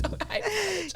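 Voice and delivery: high, soft fake-proper apology; mock polite. Girl mocking politeness to apologizing guy. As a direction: put on a prim polite voice. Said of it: "And I'm like".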